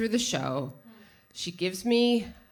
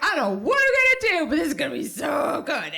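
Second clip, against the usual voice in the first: giddy; 8 semitones higher, 4 dB louder, faster than usual. Retold or imitated. Retold giddy thought.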